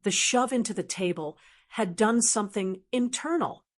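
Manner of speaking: plain reading voice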